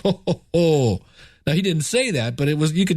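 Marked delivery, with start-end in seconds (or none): 0.1-1.0 hammed-up ho ho ho; mock hearty laugh. Storyteller laughing at the panicking host, hammed-up laugh.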